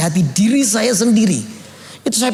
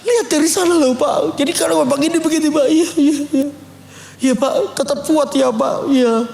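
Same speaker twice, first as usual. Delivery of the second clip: higher, teary voice urging him on; half-crying encouragement, voice lifted; tearful counseling; 6 semitones higher than usual. Retold or imitated. Pastor advising a church member while crying, tearful. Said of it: "saya tambah nangis".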